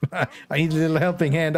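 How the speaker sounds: giggly, sheepish plea for help; laughing. Real, not acted. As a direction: laugh through it, a bit embarrassed. Laughing, asking teammates for help.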